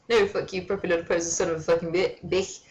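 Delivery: usual speaking voice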